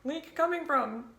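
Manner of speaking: high squeaky cartoon tire; leaky tire voice. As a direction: play a worried leaky tire. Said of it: "It's like you're a leaky tire".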